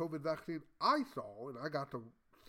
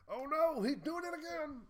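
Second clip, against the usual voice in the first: high-pitched mimicry of scandalised critics; mock alarm; 13 semitones higher than usual. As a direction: cry out in exaggerated horror.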